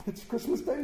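soft, high murmur of delight; hushed, wondering self-talk; to himself. A narrator's reading. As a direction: marvel privately, half under your breath. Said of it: "Scrooge said to himself".

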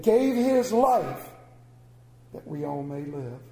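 impassioned Sunday sermon; preaching. Acted. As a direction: proclaim it to the congregation.

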